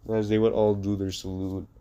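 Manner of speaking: plain reading voice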